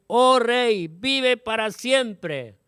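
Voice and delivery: high, slow, loud reverent answer; Daniel hailing the king from the lions' den. A narrator's reading. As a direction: call up to the king with devotion.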